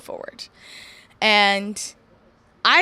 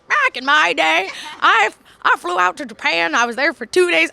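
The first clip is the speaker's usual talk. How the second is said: louder, higher put-on old-lady accent; old woman; 5 semitones higher, 6 dB louder than usual. Wrestler imagining herself at eighty, hammed-up old woman. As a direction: play an old woman reminiscing loudly. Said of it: "I have this accent all of a sudden as an old woman".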